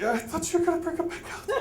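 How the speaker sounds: high, fast, whimpering complaint; hammed-up teary whine; tearful whine. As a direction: whine it like a heartbroken child. Tearful protest to cargo guy.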